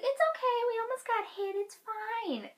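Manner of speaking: airy high-pitched imitation; mock high reassurance. Girl waving off a near miss, high mock reassurance.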